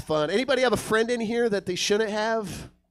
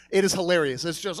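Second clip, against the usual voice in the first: ordinary delivery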